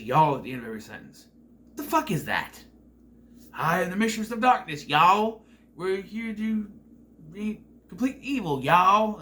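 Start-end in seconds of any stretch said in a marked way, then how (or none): 0.0-0.4 a mocking southern y'all, louder; mock southern drawl. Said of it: "she talks in a southern accent and says". 3.5-9.2 higher, louder mock southern accent, hammed-up spooky; mock southern creepy mistress. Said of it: "she talks in a southern accent".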